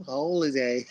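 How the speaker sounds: a hint of a mocking British accent on "Holiday". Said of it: "dumb British accent".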